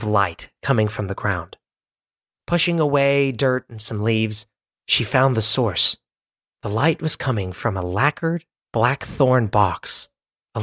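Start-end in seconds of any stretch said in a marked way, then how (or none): none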